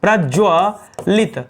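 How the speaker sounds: प्रज्वलित slowly as praj-jwa... lit; slow spelling-out of प्रज्वलित; broken into syllables. Shown as a demonstration. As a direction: stretch ज्व, pause, then lit in प्रज्वलित. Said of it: "कैसे लिखा जाएगा?".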